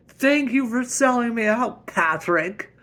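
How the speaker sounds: loud, high-pitched protest; outraged sarcasm. Acted. Husband betrayed by his friend over spending, loud sarcasm. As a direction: shout sarcastic thanks.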